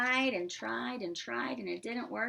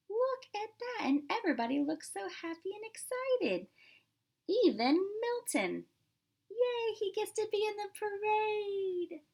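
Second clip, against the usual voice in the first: much higher, bright cheerful wonder; excited gushing; 10 semitones higher than usual. Real, not acted. Reader to children, thrilled at the happy parade picture.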